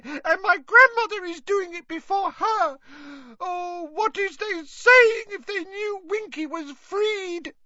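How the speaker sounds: very high, crying elf lament building to a wailing shout; Winky weeps for Mister Crouch, high and crying out; bawling house-elf. Acted. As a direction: sob over her lost master, ending in a wail. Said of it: "and bawled".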